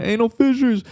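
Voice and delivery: high-pitched mock yell; retold heckle. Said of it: "both times I yelled shit out".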